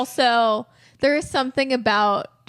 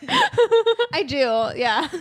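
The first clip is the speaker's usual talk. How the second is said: laughing; 6 semitones higher, much slower than usual. Real, not acted. Laughing while admitting being vampire.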